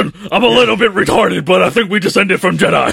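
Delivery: lightly voiced dim believer; dopey conspiracy guy. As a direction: play a dopey true believer. Acting a conspiracy theorist.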